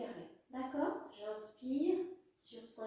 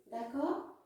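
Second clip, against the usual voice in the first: ordinary delivery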